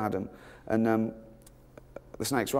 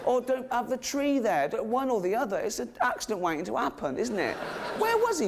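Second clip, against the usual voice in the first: high-pitched exasperated rant; comic indignation; 11 semitones higher than usual. Real, not acted. Comedian questions why God put the snake there, comic indignation. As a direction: argue the absurdity in rising disbelief.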